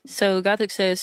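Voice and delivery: ordinary delivery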